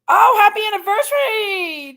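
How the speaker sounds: loud, high, tearful cry of joy. Real, not acted. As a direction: burst out through happy tears. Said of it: "I'm still a little crying here".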